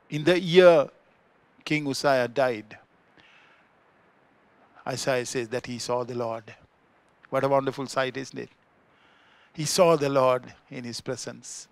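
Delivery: ordinary delivery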